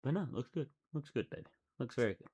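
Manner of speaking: soft reassurance. Real, not acted. Boyfriend reassuring his girlfriend about her dinner, soft and affectionate. Speaking to girlfriend after teasing.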